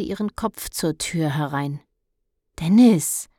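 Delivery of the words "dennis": louder, reproachful calling of his name; mother scolding from the doorway. Acted. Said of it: "sagte sie in vorwurfsvollem Ton". (vorwurfsvoll, lauter gerufen)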